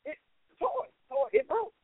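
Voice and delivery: imitating a child; high child voice. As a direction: play a kid excusing himself. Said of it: "children come to you".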